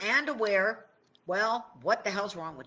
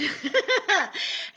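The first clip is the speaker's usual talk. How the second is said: burst of laughter; 10 semitones higher, 5 dB louder than usual. Real, not acted. Laughing at own age joke.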